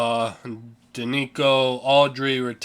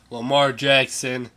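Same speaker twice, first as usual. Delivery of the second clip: ordinary delivery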